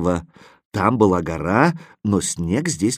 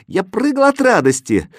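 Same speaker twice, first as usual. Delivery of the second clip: usual speaking voice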